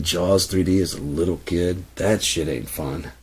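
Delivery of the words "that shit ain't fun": ordinary talk, nothing marked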